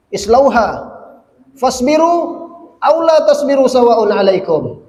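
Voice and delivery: loud, high-pitched Arabic recitation; forceful recitation. Retold or imitated. Preacher recites God's command to those in hell, forceful. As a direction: cry out the verse as a stern command.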